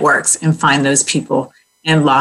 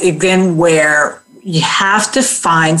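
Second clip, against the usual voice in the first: normal talking voice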